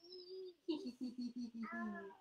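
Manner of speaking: quick hee-hee-hee; tittering pretend-munching giggle; silly play giggle. Mother pretending to eat baby feet, silly giggle.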